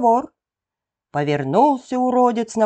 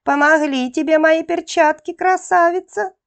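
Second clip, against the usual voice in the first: high, sly gnome voice; a gleeful little gnome; gnome's voice; 8 semitones higher than usual. A narrator's reading. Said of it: "пританцовывая, спрашивает". (высокий хитрый голос карлика)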